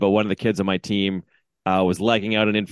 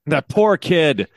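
slow, raised, indignant scolding; re-enacted coach's outrage, higher and drawn out; shaming the umpire; 4 semitones higher, slower than usual. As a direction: scold the umpire, leaning on each word. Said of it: "and shaming him".

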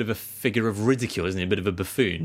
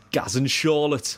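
slow, higher-pitched Geordie catchphrase; attempted Geordie accent; 5 semitones higher, slower than usual. Host finding his way into the voice, attempted Geordie accent. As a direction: draw out a bad Geordie impression. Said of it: "Looking for a kind of Geordie".

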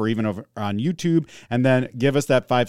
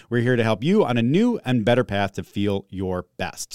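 ordinary talk, nothing marked

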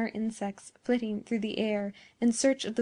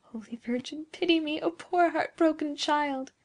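high, plaintive prayer; heartbroken moan; 6 semitones higher than usual. A narrator's reading. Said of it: "with a low moan out of her heart".

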